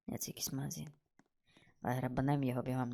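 very soft, near-whispered remark; hushed aside. Real, not acted. Player talking to himself while scouting a shop, hushed. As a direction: say it under your breath.

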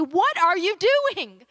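high, slow, aching challenge; God confronting a complacent church, exasperated; exasperated rebuke. Retold or imitated. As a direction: plead with them to wake up. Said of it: "the Spirit of God would say".

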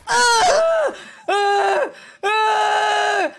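high loud screams; panicked screaming; scared scream. Acted. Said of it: "Why did your voice get so low right there?".

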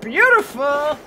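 loud Beautiful; excited. Real, not acted. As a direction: cheer the shot. Clean kill shot.

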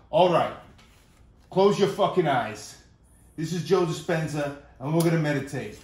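gruff meditation guru. Retold or imitated. Mock guru leading a meditation, loud and pushy. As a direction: bark calm instructions like a rude guru. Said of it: "my impression of a guy named Joe Dispenza".

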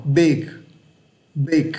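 "Big" with the voiced g sounded; don't drop the g of "Big"; final g kept. Shown as a demonstration. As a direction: model English "Big", falling, g released. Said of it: "âm G này các bạn đừng bỏ".

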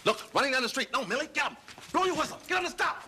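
high, rising urgent yelling; urgent alarm. Acted. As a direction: urge her on before they escape. Urging her at real crooks.

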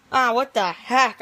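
ordinary talk

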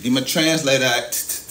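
hammed-up translator bit; mock official. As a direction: play it up like pulling out a gadget. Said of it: "let me translate it".

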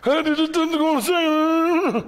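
a hammed-up high wife voice calling out Thomas; mock wife voice. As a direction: squeak it out as the suspicious mother. Playing the wife's reply.

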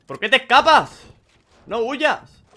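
high, loud, drawn-out shout after a fleeing enemy; frantic yell. Real, not acted. Gamer chasing a fleeing enemy, loud shouting. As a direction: bellow at the one getting away. Enemy running away mid-fight.

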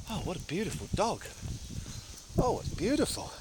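soft, high, adoring coo; cooing at a dog. Real, not acted. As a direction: gush over the cute dog. Sees a dog on walk.

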